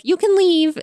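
high-pitched mock of a rude worker; rude dismissal. Worker dismissing a customer at the drive-through, mock rude. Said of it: "just being, like, rude".

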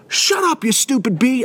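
high-pitched mock snap; imitated explosive outburst. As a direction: act out a kid exploding, without full volume. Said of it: "he explodes on this lady".

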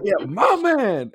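slow, high stretched "my man"; drawn-out cheer. Retold or imitated. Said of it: "So all of a sudden I do that".

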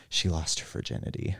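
hushed whisper, dropping the voice; whispered secret. Retold or imitated. Teen retelling a private confession, whispering. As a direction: whisper it like gossip.

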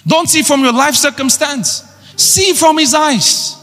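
high, ringing pulpit voice climbing with each line; full-force sermon delivery; fiery preaching. Acted. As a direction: preach it out to the whole church.

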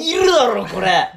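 high, very loud indignant shout; exasperated outburst. Acted. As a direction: yell it in comic outrage. Exasperated outburst at the imagined clerk.

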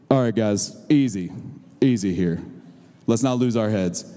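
deep, calm big-man voice; Taylor Warren. Retold or imitated. Gentle giant head coach calming the crowd, low booming voice. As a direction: drop low and settle everyone down. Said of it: "big with a booming voice".

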